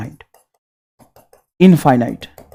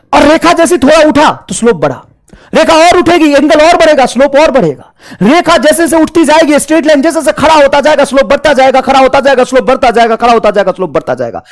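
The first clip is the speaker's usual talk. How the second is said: rising enthusiasm; 6 semitones higher than usual. Real, not acted. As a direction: drive it home with growing energy, then ease off. Teacher builds the rising-slope idea.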